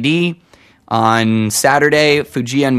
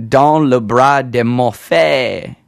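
slow, careful bad French accent; slower than usual. Shown as a demonstration. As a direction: sound out the French title slowly. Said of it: "I'm pronouncing it terribly".

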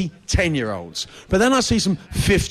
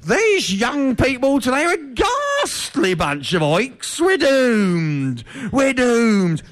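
put-on much higher, slow, scandalised old grumbler; disapproving elder; 6 semitones higher, slower than usual. Comic voicing disapproving proto-human elders, high and scandalised. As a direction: wail it like a tutting ancestor. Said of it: "another way of saying".

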